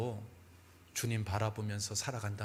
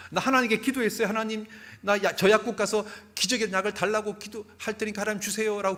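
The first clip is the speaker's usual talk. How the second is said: much higher, loud tearful pleading; crying child; 8 semitones higher, 7 dB louder than usual. Retold or imitated. Girl telling how she prayed, tearful and loud. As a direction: plead between sobs like a little girl. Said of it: "그래서 막 애가 우는 거예요".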